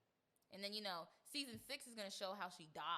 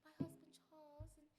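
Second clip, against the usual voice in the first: a higher, much softer voice, imitating her; softly, like a hurt princess confiding; soft-spoken imitation; 8 semitones higher, 8 dB quieter than usual. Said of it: "she just has this soft-spoken voice".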